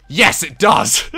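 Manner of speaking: loud, high, drawn-out cheer; triumphant shout. Real, not acted. Player finding out that the trick works, triumphant shout.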